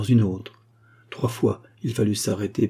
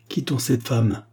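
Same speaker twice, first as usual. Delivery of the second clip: usual speaking voice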